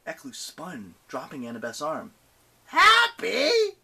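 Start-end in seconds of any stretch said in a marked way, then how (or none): 2.7-3.8 loud, high screech rising into a question; affronted, high-pitched shouted question; outraged demand. A narrator's reading. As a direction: demand it in a high monster's shriek. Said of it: "she demanded".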